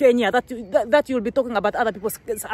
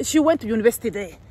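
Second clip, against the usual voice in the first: ordinary talk, nothing marked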